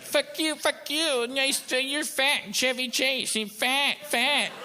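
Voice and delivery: high-pitched bratty kid impression; mocking kid voice. Comedian mimics an online kid insulting him, mocking kid voice. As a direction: imitate a heckling kid far above your own pitch. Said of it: "the kid's like".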